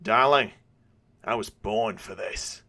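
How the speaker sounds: put-on a cocky Aussie rogue in a light Australian accent; a hint of an Australian accent, relishing the moment; thick Australian accent. A narrator's reading. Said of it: "in a thick Australian accent, he says".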